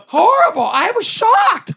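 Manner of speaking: high, loud exclamation of horror; emphatic shock. Real, not acted. Author recounting publishing woes, emphatic shock. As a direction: exclaim it dramatically, loud and drawn out at the end.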